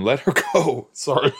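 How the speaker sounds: real laugh breaking into the words. Real, not acted. Viewer who shouldn't be laughing at the scene, laughing. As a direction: try to say it through laughter. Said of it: "I shouldn't be laughing".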